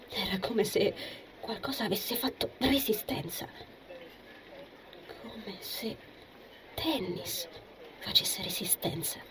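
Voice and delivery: soft, halting murmur with pauses; hushed thinking aloud; murmured to oneself. A narrator's reading. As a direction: murmur it privately, piece by piece. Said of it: "mormorò tra sé".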